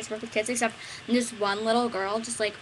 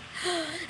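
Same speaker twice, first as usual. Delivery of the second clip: excited gasp; 7 semitones higher, 6 dB quieter than usual. Retold or imitated. Girl delighted.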